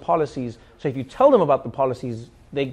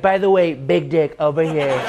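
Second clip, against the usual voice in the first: Trump boast; 3 dB louder than usual. Retold or imitated. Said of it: "Trump came back ... he was like".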